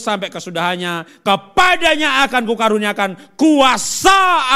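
very loud, slow, drawn-out preacher's proclamation; full-force solemn scripture reading; proclaimed scripture. Acted. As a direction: thunder the passage out, stretching key words. Reads scripture passage aloud forcefully.

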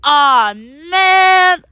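high, drawn-out storybook dismay; foiled grumble. Acted. Swiper foiled by Nora and Dora, mock dismay. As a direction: draw it out as a beaten villain.

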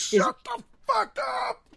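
high, slow, strained mock shout; mock yell. Shouting down a friend who keeps asking questions during movies. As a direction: yell it like you've finally snapped.

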